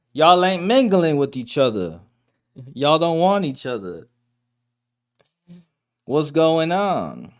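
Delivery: exasperated scolding. Real, not acted. Streamer scolds singles who ignore each other, exasperated. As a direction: plead with both sides in exasperation. Mock scolding of both dating camps.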